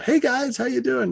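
high, chirpy hello; oblivious high-pitched cheer; cheery greeting. Retold or imitated. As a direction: greet your pals far too brightly. Cheery greeting to cranky friends.

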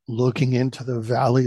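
plain reading voice

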